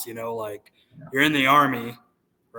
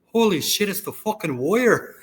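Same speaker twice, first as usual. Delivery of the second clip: high-pitched excited Scottish grandfather; raised-pitch Scottish burr, retold; Scottish accent; 7 semitones higher than usual. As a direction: imitate an amazed Scottish grandfather. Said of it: "very deep Scottish accent".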